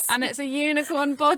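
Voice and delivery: high, loud gushing delight; excited. Real, not acted. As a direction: gush over something adorable. Gushing over the potty photo.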